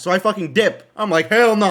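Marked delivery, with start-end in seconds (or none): 1.3-1.7 high, loud retold panic; alarmed refusal. Rider fleeing the man who hit him, alarmed. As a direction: blurt it out as you bolt.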